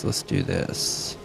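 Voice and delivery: breathy slow whisper to himself; slow whispered self-hype; whispered pep talk. Real, not acted. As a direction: psych yourself up under your breath. Psyching up before fight.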